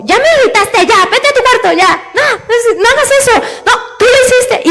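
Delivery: put-on high, exasperated parent snapping at a child; irritated parent. Preacher mimics an irritated parent sending the child away, high and exasperated. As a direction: play the fed-up parent sending the kid to their room, pitched high. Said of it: "hay veces que ya cualquier cosa es como".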